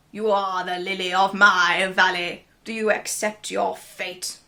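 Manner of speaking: smug conqueror's voice, lightly done; Caesar voice. Retold or imitated. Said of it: "That wasn't the voice I had from earlier".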